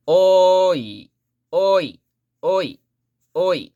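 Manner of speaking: "Oi" starting high and loud, gliding down; high-to-low glide. Shown as a demonstration. Teacher repeating for learners, "Oi" high then falling. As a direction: hit the "Oi" high, then let the end drop. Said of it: "Like that".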